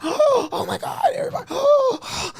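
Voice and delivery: very high gasping mock scream; panicked crowd. Everybody seeing the gun pulled, mock panic. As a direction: gasp and squeal like a frightened crowd.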